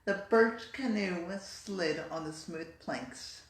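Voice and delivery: slow, careful recording read; a trainee repeating the test sentence; practice sentence. Shown as a demonstration. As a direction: read it slowly and precisely. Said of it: "the sentence I practiced the most".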